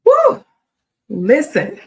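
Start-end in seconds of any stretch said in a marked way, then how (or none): 0.0-0.4 loud high whoop; excited whoop. Real, not acted. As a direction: cheer it out loud. Excited cheer before announcement.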